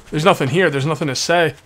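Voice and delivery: ordinary talk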